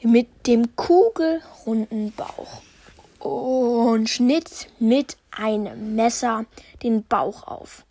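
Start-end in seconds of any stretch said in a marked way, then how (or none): none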